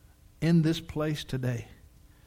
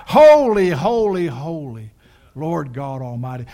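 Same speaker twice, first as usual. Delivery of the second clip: crying out; 8 dB louder than usual. Retold or imitated. Preacher voices heavenly beings around God's throne, crying out. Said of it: "and they cry out".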